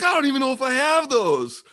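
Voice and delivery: high-pitched, helpless mock Scot; exaggerated Scottish bafflement; bad Scottish accent. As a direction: protest in a hammy Scottish voice. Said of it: "my Scottish accent".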